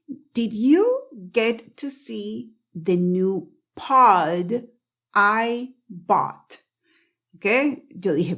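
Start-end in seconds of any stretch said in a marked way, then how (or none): 0.1-6.7 very slow, word by word, "pod" and "I" kept apart; careful, separated slow sentence; slow demonstration. Shown as a demonstration. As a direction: say it slowly with a full D in "pod" before "I". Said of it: "voy a decir esta frase lenta".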